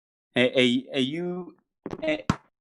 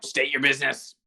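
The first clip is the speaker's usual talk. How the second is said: lightly voiced gruff hobgoblin guard; a hint of a hobgoblin demanding entry; 3 semitones lower, 4 dB louder than usual. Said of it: "the hobgoblin voice be".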